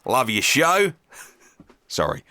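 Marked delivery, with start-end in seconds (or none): 0.0-0.9 put-on Australian accent, playful imitation; Aussie accent. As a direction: read it as a hammed-up Aussie. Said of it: "I can't help but do an Aussie".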